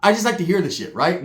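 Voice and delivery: normal talking voice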